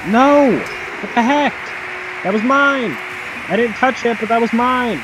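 loud high furious shouting; outraged yelling. Real, not acted. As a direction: scream it at the screen. Outraged at stolen goal.

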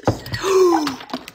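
loud shocked gasp; sharp intake of breath in alarm; startled gasp. Real, not acted. Spilling iced coffee.

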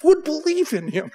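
high, slow, laughing prompt; excited coaxing the next words; excited eager prompting. Retold or imitated. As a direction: egg him on, thrilled. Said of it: "I'm starting to get excited".

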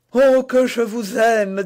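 loud, high cry of love; passionate declaration. Acted. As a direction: burst out adoringly at full voice. (déclaration d'amour exaltée, à pleine voix)

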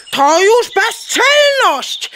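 high, slow, scandalised indignation; mother hen scandalised by her daughter; indignant outrage. Acted. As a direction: drag out the outrage at her cheek.

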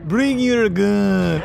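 slow, drawn-out imitation of the character's accent; game character accent, half speed; Salty Pete. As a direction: mimic a game character's accent, stretching each word. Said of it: "comment about the accent of".

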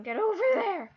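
high, loud, exasperated plea; loud, strained exasperation; frustrated yell. Real, not acted. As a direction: shout at your game character to move. Frustrated at failed game jump.

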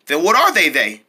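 normal talking voice

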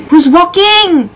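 high, drawn-out alarmed question. Real, not acted. Player startled by footsteps in a horror game, alarmed. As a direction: stretch WALKING into a high wail.

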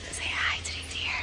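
gentle hushed whisper. Real, not acted. Telling the toddler to greet the deer, whispered. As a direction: keep it barely audible near the deer.

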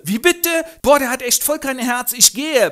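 sehr hoch, laut, prollig; Straßengöre. Acted. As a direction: patzige Göre im Jugendslang. Eliza empört ab. (hohe, laute Prollstimme)

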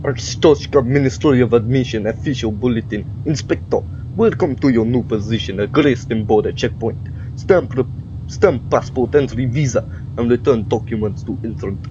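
mock Russian accent, badly faked; terrible Russian accent. Player explains the request and reads the bulletin, terrible Russian accent. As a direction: keep up a hammy Russian accent. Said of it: "keep this accent going for the entire playthrough".

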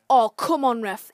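loud exasperated appeal to the ref; pleading with the referee. Real, not acted. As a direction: shout it at the ref in disbelief. Appealing to the ref after foul.

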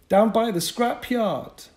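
put-on high, loud boyish voice; boy's report. Paperboy tells Bennett where the fog is, boyish and loud. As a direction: report it like a young scout.

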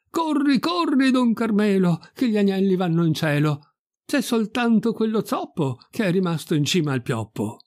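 high-pitched alarmed call. Acted. Pirolo crying out to Don Carmelo about the lambs, calling out. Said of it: "cominciò a gridare".

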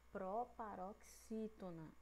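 Proparoxítona slowly split, syllable by syllable; slow say-along. Shown as a demonstration. Teacher repeating for pupils, Proparoxítona slow and segmented. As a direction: draw out each part of Proparoxítona. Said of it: "Vamos lá de novo".

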